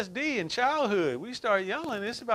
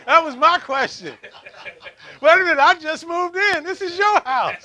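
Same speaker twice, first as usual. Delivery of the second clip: laughing; 8 semitones higher, 12 dB louder than usual. Real, not acted. Laughing at his own scare story.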